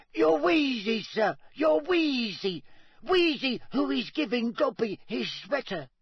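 squeaky, high-pitched insistent elf; Dobby. A narrator's reading. Elf insisting Harry can do the task, squeaking. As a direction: squeak it with utter conviction. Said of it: "squeaked Dobby".

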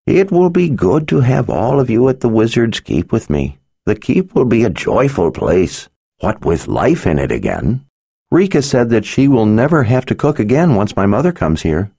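put-on gentle old man, tender; lightly voiced kindly old wizard. A narrator's reading. Narrator voices Zed.

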